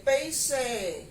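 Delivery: Paraná-style "peixe" with a full final "ê"; Paraná accent, clear closed E ending "peixe". Retold or imitated. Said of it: "eles pronunciam as palavras com som de E".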